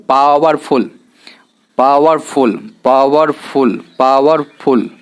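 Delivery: पावरफुल repeated deliberately as "paawar-ful", falling at the end; pronunciation drill. Shown as a demonstration. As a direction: model पावरफुल for learners, stretched "paa-war", then "ful". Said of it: "अब इसको उच्चारण कीजिए". (पावरफुल को "पावर-फुल" की तरह साफ़, दोहराकर बोलें)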